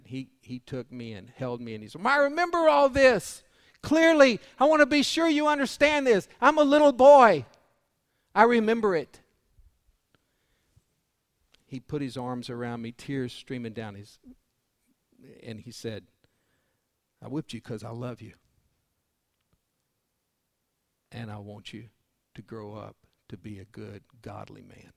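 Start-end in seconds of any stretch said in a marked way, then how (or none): 2.0-9.2 high, loud, straining father's plea; a preacher voicing a weeping father; impassioned cry. Dad's tearful words, preached loudly. 17.2-24.9 soft, low fatherly words; father comforting his son; tender father. Retold or imitated.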